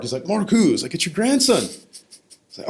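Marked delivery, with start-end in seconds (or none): 0.3-0.7 high, loud imitated grandfather; loud retold puzzlement. Grandfather not recognizing grandson on phone, loud imitation. 0.9-1.6 high, loud, insistent retelling; retold phone shout. Grandson insisting who he is to grandfather, retold shout.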